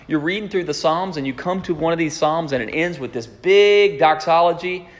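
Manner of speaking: ordinary talk, nothing marked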